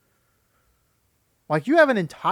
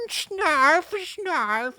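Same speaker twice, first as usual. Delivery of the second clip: high, whining nasal-free cartoon sidekick; whiny Snarf; 11 semitones higher than usual. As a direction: whine it out like a sniveling mascot. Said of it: "the snivelly, whining".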